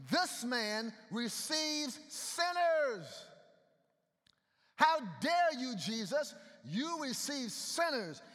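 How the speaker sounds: hammed-up outraged accuser; indignant Pharisees. Preacher acting out Pharisees scolding Jesus, indignant.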